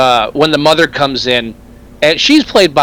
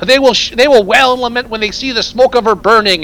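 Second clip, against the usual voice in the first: raised, higher preacher voice; street preacher; 7 semitones higher than usual. Retold or imitated. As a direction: proclaim doom like a street preacher. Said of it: "crazy street preacher".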